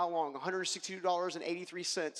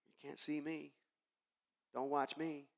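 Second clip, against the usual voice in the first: hushed inner voice; 4 semitones lower, 8 dB quieter than usual. Retold or imitated. Hiding from Steve, inner voice.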